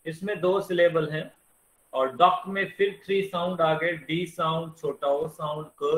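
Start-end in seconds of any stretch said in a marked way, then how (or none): none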